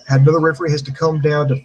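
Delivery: ordinary talk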